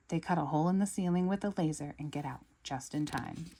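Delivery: plain reading voice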